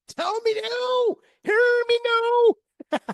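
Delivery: high, very slow drawn-out plea; pleading. Retold or imitated. Tourist eagerly begging the gunman for his story, high and drawn out. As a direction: stretch every word, way up high.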